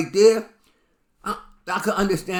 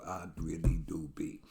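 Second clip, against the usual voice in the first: whispered aside; 7 semitones lower, 9 dB quieter than usual. Real, not acted. Whispered aside about street life.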